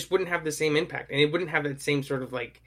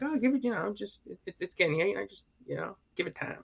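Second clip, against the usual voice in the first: higher, softer, a light take on a laid-back broker; imitating McConaughey; 5 semitones higher, 5 dB quieter than usual. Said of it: "exactly like he does".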